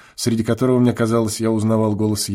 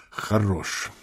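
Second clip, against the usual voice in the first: soft drawn-out verdict; 4 semitones lower, 9 dB quieter than usual. A narrator's reading. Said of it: "непременно скажет".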